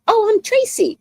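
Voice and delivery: high, chirpy innocent self-introduction; sweet innocent me. Retold or imitated. Answering a coworker's question, high and chirpy. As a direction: play it sweet and clueless. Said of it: "And I go".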